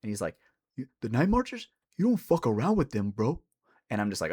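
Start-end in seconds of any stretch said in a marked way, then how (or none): 0.8-3.4 imitated local warning, pitched up with a rising question; so-serious crackhead. Crackhead warning storyteller about Night Marchers, mock deadly serious. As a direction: play the deadly serious stranger giving a warning. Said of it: "he immediately goes so serious".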